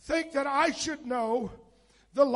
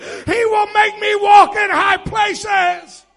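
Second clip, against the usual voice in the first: very loud, slow, rising sermon climax; preaching at full force; 5 semitones higher, 16 dB louder, slower than usual. Acted. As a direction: hammer each yet into the crowd.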